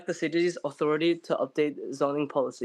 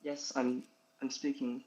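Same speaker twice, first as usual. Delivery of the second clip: faint and distant on the mic; barely audible; 9 dB quieter than usual. Real, not acted. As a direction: voice barely picked up. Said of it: "You're very, very soft coming in".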